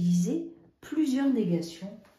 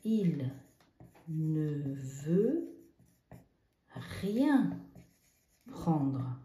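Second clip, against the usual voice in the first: slow dictation; much slower than usual. Shown as a demonstration. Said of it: "c'est rien".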